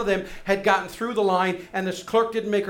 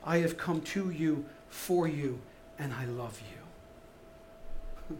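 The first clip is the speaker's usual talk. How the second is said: soft, low and tender; tender Jesus; 6 semitones lower, 9 dB quieter than usual. Retold or imitated. Preacher voices Jesus promising love to the woman, soft and tender. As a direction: hush it down like a loving promise. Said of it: "Jesus rather says".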